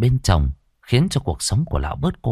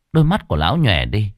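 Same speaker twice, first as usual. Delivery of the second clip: plain reading voice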